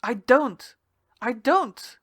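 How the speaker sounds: furious, loud refusal; shouted denial. Acted. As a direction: yell him away from her. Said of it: "I struck out at her".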